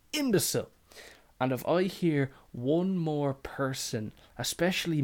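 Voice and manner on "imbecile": high, shrill-pitched imbecile; mock outraged insult. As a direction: spit out the final insult up high. End of imagined insult.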